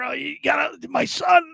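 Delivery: imitating a frail patient, very high; barely able to talk. Sick dad tells nurse about his son, barely able to talk. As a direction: squeeze the words out high and thin. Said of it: "he can barely talk".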